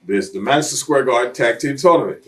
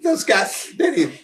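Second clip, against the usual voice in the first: much higher, half-laughing tearful complaint; mock tearful whine; 11 semitones higher than usual. Retelling the upset wrestler on the bus, high mock whine. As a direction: whimper like a hurt wrestler, hammed-up. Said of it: "he's almost like in tears".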